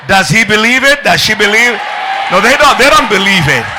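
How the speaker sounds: full-force preaching. Acted. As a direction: fire the questions out like a revival preacher.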